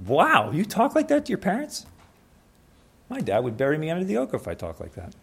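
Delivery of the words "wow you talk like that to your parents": big loud astonished whoa, way up high; shocked. Retold or imitated. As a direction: gasp in disbelief at the rude kid. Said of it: "and you're like".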